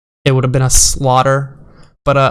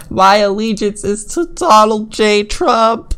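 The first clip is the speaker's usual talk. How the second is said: higher, mocking whiny-devotee voice; mocking zealot voice; 7 semitones higher, 3 dB louder than usual. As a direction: parody the tweet's fanatic author. Mocking quoted zealot tweet.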